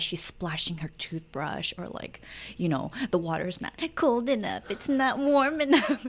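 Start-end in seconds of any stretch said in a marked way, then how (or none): 3.1-6.0 high, dragged-out mock complaint; imitated fussy child. Child at toothbrushing finding fault with the water, imitated fussy whine. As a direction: whine like a picky little kid. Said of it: "like, fussy the whole time".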